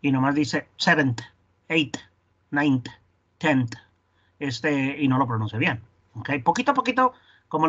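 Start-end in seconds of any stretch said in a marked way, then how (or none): 0.8-3.7 "seventh", "eighth" ending in a hard T; non-native T ending. Retold or imitated. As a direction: imitate a learner saying "ninth" as "naint". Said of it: "alguien que tiene inglés como segundo idioma".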